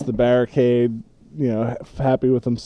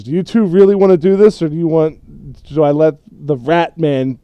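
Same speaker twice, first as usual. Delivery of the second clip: higher, louder, fast challenge to the pair; threatening challenge; 4 semitones higher, 6 dB louder than usual. Acted. Myra challenges the two fighters, higher and louder. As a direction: dare them to keep fighting. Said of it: "both of them and says".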